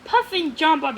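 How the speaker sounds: plain reading voice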